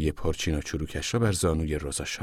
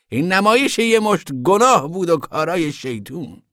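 high, emphatic, righteous speech; zealous moralizing woman; 7 semitones higher, 4 dB louder than usual. A narrator's reading. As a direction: pronounce judgment in a raised, insistent voice. Voices the preachy woman. (زن مذهبی، با صدای زیر و قاطع)

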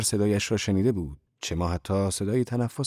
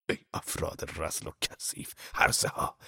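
contemptuous hiss; 3 semitones lower, 8 dB quieter than usual. Acted. Zorba curses the monks.

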